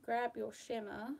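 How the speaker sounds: ordinary talk, nothing marked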